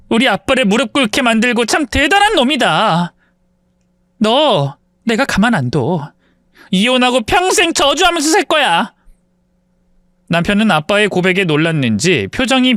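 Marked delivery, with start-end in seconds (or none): 0.1-8.9 hammed-up yelling. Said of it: "소리를 고래고래 질렀어요".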